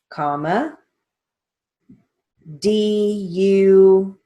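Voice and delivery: slow, deliberate dictation read with pauses. Shown as a demonstration. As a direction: dictate each word slowly and clearly for the class. Dictation with spelled name.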